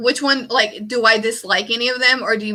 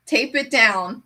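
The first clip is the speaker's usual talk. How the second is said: normal talking voice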